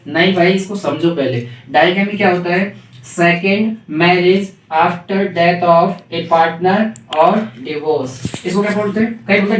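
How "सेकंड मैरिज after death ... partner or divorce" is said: slow dictation. Shown as a demonstration. Teacher dictating a definition.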